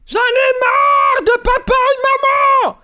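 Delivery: slow, high and loud tantrum scream; childish yell. Retold or imitated. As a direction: drag it out like a furious kid.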